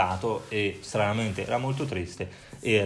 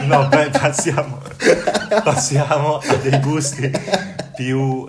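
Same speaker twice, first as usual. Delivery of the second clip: laughing; 5 semitones higher, 7 dB louder than usual. Real, not acted. Laughing while changing the subject.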